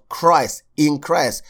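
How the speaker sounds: normal talking voice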